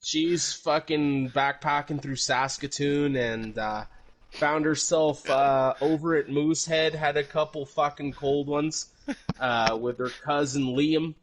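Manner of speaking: over-the-top Canadian bro telling a story; Canadian accent. Pretend. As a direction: tell it like a boisterous Canadian hoser. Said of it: "do this in your Canadian accent".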